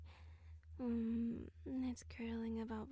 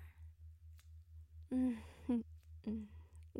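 breathy satisfied laugh; whispered laugh. Acted. Pleased with the pet.

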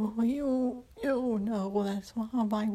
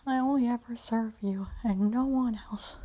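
normal talking voice

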